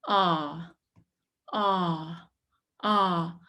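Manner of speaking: ah with the tongue low and back; lax short A. Shown as a demonstration. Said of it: "the lax short A".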